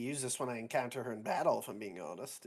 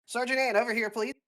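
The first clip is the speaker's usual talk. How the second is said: calling over; 7 semitones higher than usual. Acted. Lieutenant calls sergeant over in game.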